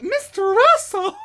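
high, slow outraged gasp; shocked receptionist. Retold or imitated. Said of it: "It's like".

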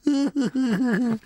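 high, cracking into laughter; laughing disbelief. Real, not acted. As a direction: ask it while breaking up laughing.

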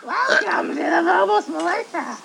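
very high creepy doll squeak; little doll voice. Shown as a demonstration. Doing the intro as the little doll. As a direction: pitch way up like a tiny possessed doll. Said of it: "the voice of the little doll".

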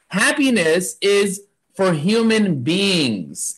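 beings drawn out slowly, underlined; stress on being. Shown as a demonstration. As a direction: lean on beings and slow it right down. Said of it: "I'm gonna underline the word being".

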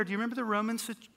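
usual speaking voice

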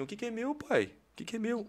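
soft, high, stunned question; retold timid astonishment; hushed disbelief. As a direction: ask quietly, not daring to believe it. Retold disbelief at being given course.

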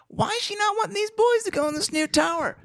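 very high, put-on old woman voice; old lady falsetto. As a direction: keep the squeaky old lady going.

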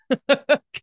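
a short high laugh that breaks through held-back tears; teary laugh. Real, not acted. Host admitting sadness to listeners, short teary laugh. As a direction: laugh it off before the tears come.

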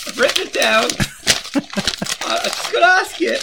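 put-on high, loud, nervous young caller; a jittery caller stumbling over his words; [name]. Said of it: "Mark, take it away".

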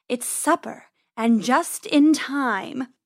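slow, purring predator voice; purring cat. Acted. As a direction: savour each word like a cat. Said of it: "she purred".

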